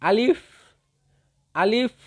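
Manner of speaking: teacher's drill of আলিফ, rising each time; repeat-after-me drill. Shown as a demonstration. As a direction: lead learners in saying আলিফ from the open mouth. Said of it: "আপনারাও আমার সঙ্গে পড়ুন".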